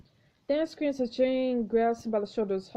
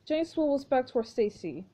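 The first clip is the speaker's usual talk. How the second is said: ordinary delivery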